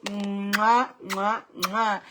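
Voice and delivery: three smacking air kisses; blown kisses. Real, not acted. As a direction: blow kisses to the audience. Blowing kisses to viewers.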